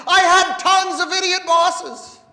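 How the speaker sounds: ordinary talk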